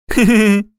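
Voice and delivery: high, short acted giggle; laugh. A narrator's reading. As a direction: giggle along. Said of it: "засмеялась Нюшка". (высокий смешок)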